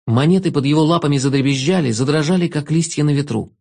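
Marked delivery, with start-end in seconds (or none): none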